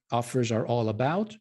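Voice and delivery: ordinary talk, nothing marked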